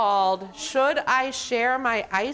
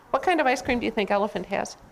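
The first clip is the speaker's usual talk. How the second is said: usual speaking voice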